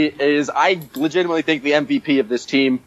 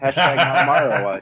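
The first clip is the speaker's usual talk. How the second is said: laughing. Real, not acted. Repeats slogan, laughing through it.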